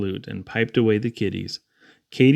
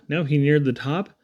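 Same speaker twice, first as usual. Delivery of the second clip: plain reading voice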